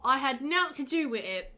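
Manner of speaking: mock Cockney. Shown as a demonstration. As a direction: read it in a jokey Cockney accent. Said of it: "Is that Cockney?".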